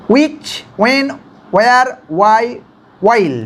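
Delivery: which, when, where, why, while with clean w starts; English wh sounds. Shown as a demonstration. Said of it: "exact যে সাউন্ডগুলো". (ইংরেজি উচ্চারণে which, when, where)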